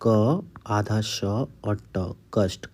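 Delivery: letters spelled slowly one by one; slow spelling out. Shown as a demonstration. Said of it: "आज का हमारा पहला शब्द है कष्ट".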